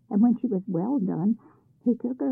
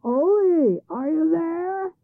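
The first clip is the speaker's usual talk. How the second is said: loud high call, are you there stretched slow; shouting across; 9 semitones higher, 7 dB louder, slower than usual. A narrator's reading. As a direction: call out across the fields to Ole. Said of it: "the troll shouted across".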